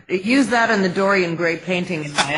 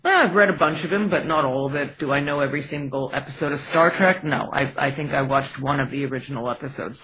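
normal talking voice

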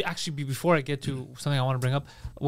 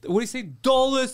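high-pitched mock indignation; lightly put-on complainer voice; loud Jersey guy; 8 semitones higher, 3 dB louder than usual. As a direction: imitate an incredulous New Jersey loudmouth. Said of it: "this guy verbalized it loud".

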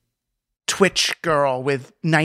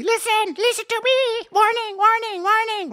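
much higher, piping alarm call; alarmed bee cell. Retold or imitated. A cell alerting those around it with a high piping alarm. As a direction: squeak out the warning like a tiny cell. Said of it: "alert the other cells around you".

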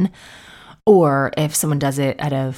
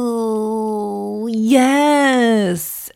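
mock reluctant yes; 6 semitones higher than usual. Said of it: "you just have to be like".

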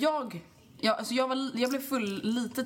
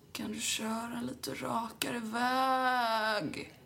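slow, strained nauseous plea; half yelling, half vomiting; 4 dB quieter, much slower than usual. Retold or imitated. Drunk passenger begging the driver, nauseous slow plea. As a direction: beg through nausea. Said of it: "halvt skriker, halvt spyr".

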